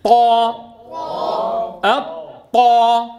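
loud held طا, ط shut tight with no breath; strong voiced ta. Shown as a demonstration. Teacher drills the class, loud hard ط in طا. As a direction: close hard on ط in الطا, then release loudly. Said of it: "أغلق المخرج جيدًا".

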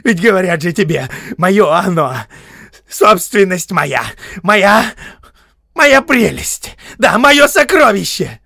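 high, loud, obsessive cries; wailing outburst over a treasure; frantic possessiveness. A narrator's reading. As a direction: claim it feverishly, drawing out key words. Bilbo frantically claims the ring.

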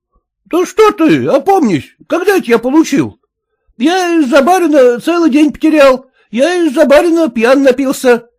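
high, protesting peasant voice; high peasant indignation; indignant peasant. Acted. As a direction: argue up high for the trout money. Peasant protests about his pay.

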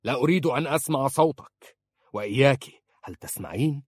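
restrained shout, easing off at the end; shouted warning. A narrator's reading. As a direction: silence her with a stern warning. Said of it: "فصاح".

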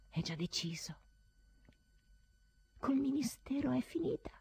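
grim, hushed resolve; a whispered verdict. Acted. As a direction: whisper the decision to yourself.

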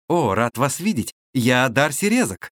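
ordinary talk, nothing marked

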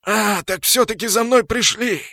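high, loud cry of realization; alarmed orc. A narrator's reading. Orc thinks they came for him, alarmed. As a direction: cry out as if cornered.

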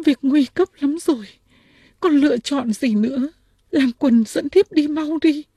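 high-pitched, urgent princess plea; acted high, pleading feminine voice; sobbing princess. A narrator's reading. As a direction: voice a distressed princess, pitched far up. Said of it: "Công chúa nức nở nói".

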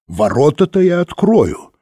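high-pitched grand proclamation; lifted, declamatory announcement; proclaiming. A narrator's reading. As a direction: proclaim it theatrically. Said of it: "провозгласил Тиббинг".

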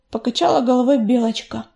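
usual speaking voice

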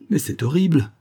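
high, swooping cry of protest; horrified exclamation. A narrator's reading. Lover rereading his farewell letter, horrified exclamation. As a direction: cry out in horror, pitch jumping up. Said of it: "s'écria-t-il".

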